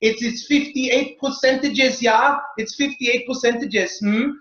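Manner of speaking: hammed-up German scientist insisting; German accent. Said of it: "I always have to do the German accent".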